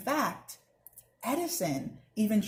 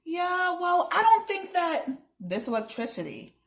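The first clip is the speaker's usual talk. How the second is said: put-on girl arguing; 10 semitones higher than usual. A narrator's reading. Ella arguing with Mister Mattal.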